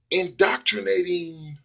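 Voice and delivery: "indoctrinating" stretched slow and high, each syllable spelled out; slow, deliberate "indoctrinating"; drawn-out word. Shown as a demonstration. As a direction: draw out "indoctrinating" slowly, as if weighing the word. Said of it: "well, indoctrinating".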